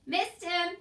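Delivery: loud, high shout. A narrator's reading. Mig shouts to Cook that she missed. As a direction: call out the miss. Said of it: "shouted Meg".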